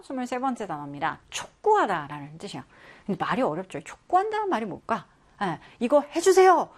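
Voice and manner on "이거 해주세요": louder, higher insistent demand for help; forceful plea. Retold or imitated. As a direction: press hard, like begging someone to act. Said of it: "이렇게 강하게". (강하게 촉구하듯 외치는 부탁)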